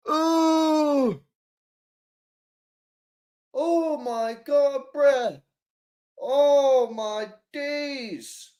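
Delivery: high-pitched, stunned and squeamish; can't believe what he just saw, drawn out; horrified disbelief. Real, not acted. Reacting to a dislocated finger.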